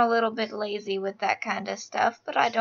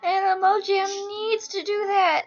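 overexcited outburst; 9 semitones higher than usual. Real, not acted. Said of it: "I'm all out of breath".

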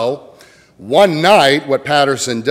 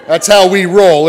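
even louder and higher quip; bigger playful punchline; 7 semitones higher, 9 dB louder than usual. Real, not acted. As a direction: push it home with a grin.

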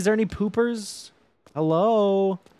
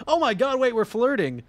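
ordinary talk, nothing marked

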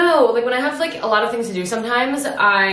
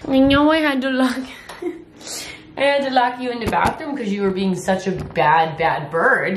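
mock scolding the bird; 4 semitones higher than usual. Real, not acted. Scolding her pet bird.

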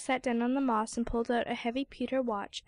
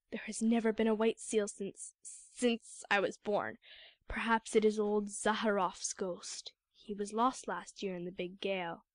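uneasy hunter dropping his voice about a ghost; fearful muttering; 2 semitones lower than usual. Acted. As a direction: mutter it like a superstitious prayer. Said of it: "began to mutter a prayer".

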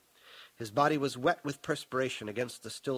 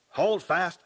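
loud, raised order; commanding shout; 4 semitones higher, 6 dB louder than usual. A narrator's reading. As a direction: call out firmly to the troops. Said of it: "Paul shouted".

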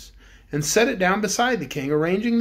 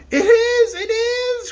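high, loud joyful cry; the cook cries out on seeing her pan; excited cry; 6 dB louder, much slower than usual. Acted. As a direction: burst out with delight, near tears. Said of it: "cried the cookie cook".

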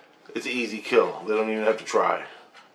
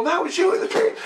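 much higher, astonished question; amazed disbelief; faster than usual. Retold or imitated. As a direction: sound floored that it was him. Amazed question after waking.

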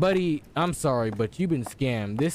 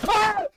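short, very high loud scream; startled scream; 6 dB louder than usual. Real, not acted. Gamer startled inside the creepy house, screaming. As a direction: yelp from a sudden jump scare. Jump scare.

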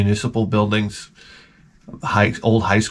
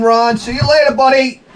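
hasty brush-off; 12 semitones higher, 3 dB louder than usual. Retold or imitated.

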